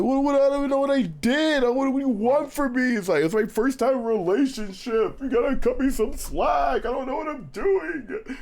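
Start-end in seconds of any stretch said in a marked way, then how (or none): 0.0-3.0 high-pitched, hammed-up desperate protest; mock teenage outburst, voice pitched way up; frantic protest. His teenage self arguing with girlfriend, frantic high mock protest. Acts out his side of argument. 3.2-8.4 high-pitched, hammed-up desperate protest; mock teenage outburst, voice pitched way up; frantic protest. His teenage self arguing with girlfriend, frantic high mock protest. Acts out his side of argument.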